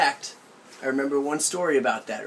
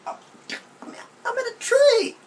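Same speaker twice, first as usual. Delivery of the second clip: put-on very high, slow, pleading child's wail; a child explaining to his aunt that he is stuck up a tree; whiny little kid; 4 dB louder, much slower than usual. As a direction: stretch it out like a stuck six-year-old.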